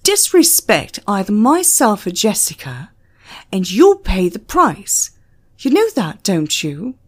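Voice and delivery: loud, harsh warning; barking threat. Acted. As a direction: bark it as a threat to obey. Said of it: "barked at him".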